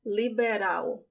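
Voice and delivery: Liberal ending in a W sound, liberau; Liberal, unrolled final L. Shown as a demonstration. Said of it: "same L that sounds like the W in cow".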